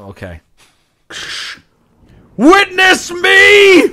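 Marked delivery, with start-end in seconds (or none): 2.4-3.8 battle cry. Pretend. Dramatic war cry while jumping.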